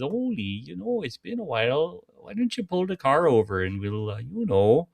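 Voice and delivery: coy Minnesota lilt; thick Minnesota accent. Acted. Lena coaxing Ole to pull over, thick Minnesota accent. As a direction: play Lena in broad Minnesotan. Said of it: "they literally had the thick Minnesota accents".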